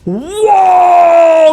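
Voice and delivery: high, loud drawn-out wow; a loud, high, long cry of amazement; big wow shout. Real, not acted. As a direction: blown away by the haul. Shows pile of full arts.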